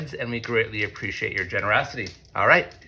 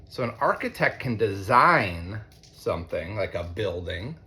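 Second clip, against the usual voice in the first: usual speaking voice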